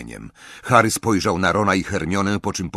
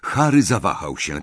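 ordinary delivery, nothing marked

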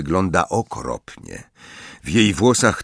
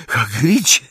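panting, high, out of breath; breathless; 12 semitones higher than usual. Acted. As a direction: gasp his name after running. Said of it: "wydyszała Hermiona".

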